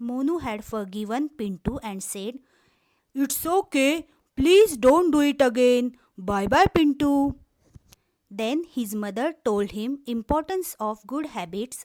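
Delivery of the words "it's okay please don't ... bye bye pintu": louder, lightly voiced bear; a gentle giant calling out goodbye; forgiving bear. Bear forgiving Pintu and saying goodbye, loud.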